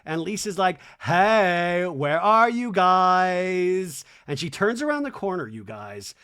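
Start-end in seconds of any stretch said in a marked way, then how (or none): 1.0-4.0 loud, long-drawn singsong call. Retold or imitated. Lisa looking for her friends, loud drawn-out mimicked call. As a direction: call down a hallway, stretching the last words.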